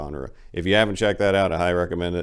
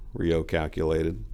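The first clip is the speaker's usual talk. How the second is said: usual speaking voice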